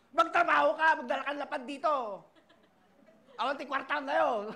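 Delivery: imitating an angry mother yelling at her son; mother scolding. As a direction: bark orders like a nagging mom. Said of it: "Sisigawan ako".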